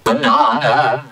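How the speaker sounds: very low, slow demonic Banana. breaking into a laugh. Shown as a demonstration. Last banana for the commenter, low slow demon voice. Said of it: "say the word banana in several different ways".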